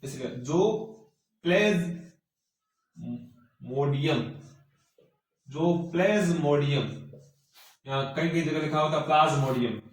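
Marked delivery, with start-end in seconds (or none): 0.3-7.1 dictating. Shown as a demonstration. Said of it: "जो मैं यहां पे लिख रहा हूं".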